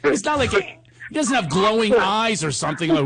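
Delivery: normal talking voice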